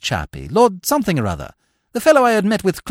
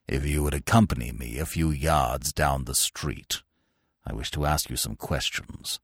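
deep, soft, icy authority; stern old grandee, quietly commanding; cold, stern Sir Roderick; 8 dB quieter than usual. A narrator's reading. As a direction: give a coldly polite order, very low.

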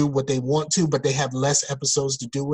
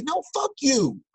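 exasperated dismissal; 11 semitones higher than usual. Retold or imitated. Retold rejection of coworker's preaching.

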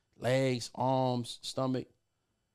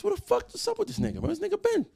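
much higher, startled retelling of his reaction; shocked outburst; faster than usual. Retold or imitated. Friend suddenly FaceTimes after vanishing, high startled outburst. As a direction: yelp it out in disbelief, pitched way up. Sees missing friend calling.

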